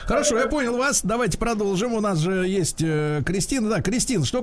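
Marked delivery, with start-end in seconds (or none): none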